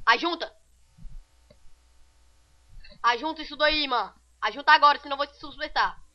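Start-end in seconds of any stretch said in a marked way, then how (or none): none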